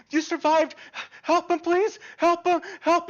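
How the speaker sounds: high, loud, frantic cries for help; hysterical pleading. Acted. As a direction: plead like a woman beside herself. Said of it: "And she is hysterical".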